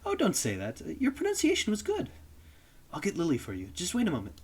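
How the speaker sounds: put-on high, gentle older lady on the phone; sweetly polite woman answering a call; polite mother voice. Woman answers the phone for Lily, high and polite.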